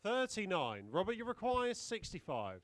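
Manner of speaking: darts score caller. Acted.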